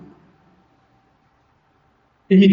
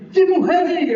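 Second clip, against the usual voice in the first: bright, much higher cheer for the king; joyful acclaim; 9 semitones higher than usual. Acted. Uzai greeting Absalão as king, joyful high cheer. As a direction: hail the new king with open joy. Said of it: "deve ter falado assim com alegria".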